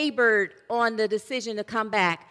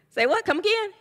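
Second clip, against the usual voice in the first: incredulous double-take; 9 semitones higher than usual. Acted. Hiker told by God to let go of the branch, incredulous double-take. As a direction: pitch climbing high in disbelief. Hiker disbelieves God's order.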